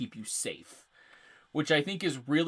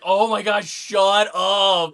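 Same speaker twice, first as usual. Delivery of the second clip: loud, high exasperated shout; upset players; 6 semitones higher, 12 dB louder, slower than usual. Acted. Host acting out players fed up with the beacon, exasperated yelling. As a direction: yell it like a player who has had enough. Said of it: "it upsets so many players".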